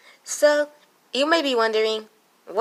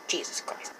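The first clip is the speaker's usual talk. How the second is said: whispered exasperation; 16 dB quieter than usual. Real, not acted. Frustrated aside.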